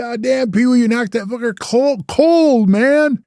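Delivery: high, excited amazement, loudest on cold; amazed cheer. Retold or imitated. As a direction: marvel at the knockout. Members cheering the knockout.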